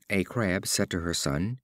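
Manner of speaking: ordinary delivery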